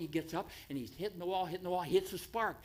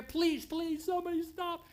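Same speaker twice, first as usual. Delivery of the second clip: high, pleading cry to a passing plane; calling up at the sky, much higher; desperate plea; 13 semitones higher than usual. Retold or imitated. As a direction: beg the plane to stop.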